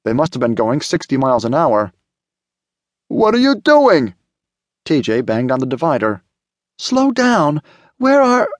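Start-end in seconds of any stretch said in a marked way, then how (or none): 3.1-4.2 high, loud, drawn-out outcry; slow, high-pitched frantic demand; panicked yell. A narrator's reading. Passenger yells at speeding abductor. 6.8-8.5 high-pitched urgent cry; alarmed, voice pitched way up; panicked demand. A narrator's reading. Passenger yells at speeding abductor.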